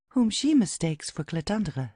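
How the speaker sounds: usual speaking voice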